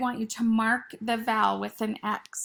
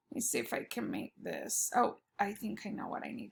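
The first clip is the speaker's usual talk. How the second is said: softly muttered to herself, barely voiced; hushed aside to self; under breath; 2 semitones lower, 10 dB quieter, faster than usual. Real, not acted. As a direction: mumble it to yourself while working. Teacher muttering to herself at board.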